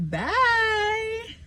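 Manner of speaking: squeaky sing-song goodbye; high-pitched bye. Real, not acted. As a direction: chirp it up high.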